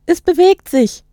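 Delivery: high-pitched cry in passing; alarmed, high acted shout; excited boy calling out. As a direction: call it out as a boy running by. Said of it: "rief er mir im Vorübereilen zu".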